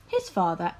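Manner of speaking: usual speaking voice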